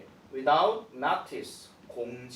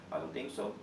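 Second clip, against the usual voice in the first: 못마땅한 말투; 6 semitones lower, 6 dB quieter, much slower than usual. Acted. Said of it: "지금 쌤 표정 보면 아시겠죠?".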